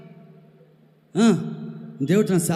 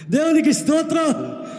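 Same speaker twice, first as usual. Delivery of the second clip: high, slow, loud praise cry; ringing preacher's hallelujah; praise shout; 9 semitones higher, 5 dB louder than usual. Acted. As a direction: lift it up like an altar call. Praise exclamation in sermon.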